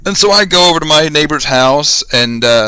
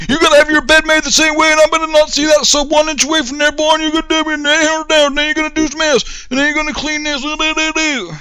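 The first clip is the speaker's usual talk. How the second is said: drill sergeant orders; 14 semitones higher than usual. Retold or imitated. Drill sergeant ordering a recruit about his bed, hammed-up high voice. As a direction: rattle off chores like a parody drill instructor. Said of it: "wanna be yelled at".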